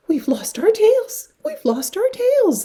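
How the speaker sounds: high, loud joyful cheer; cheering tadpoles. A narrator's reading. Tadpoles celebrating their lost tails, high loud cheer. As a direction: cheer it out high like delighted little froglets. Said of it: "they cheered".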